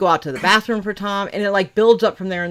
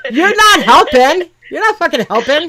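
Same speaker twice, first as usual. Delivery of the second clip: half-laughing, scold him through laughter; laughing protest; 11 semitones higher, 10 dB louder than usual. Real, not acted. Laughing at a friend's unhelpful theory, high loud protest. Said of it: "I'm not trying to help".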